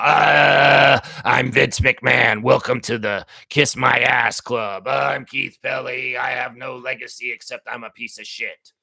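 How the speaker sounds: slow booming villain voice; loud, slow, mocking villain boast; Keith Pelley villain voice. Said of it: "why are you doing your villain voice now?".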